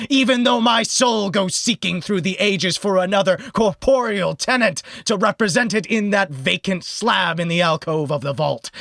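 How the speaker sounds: haunted spirit rising to a furious cry; declaiming phantom. Acted. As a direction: boom it out like a vengeful ghost claiming its grave. Ghost of burning house speaks.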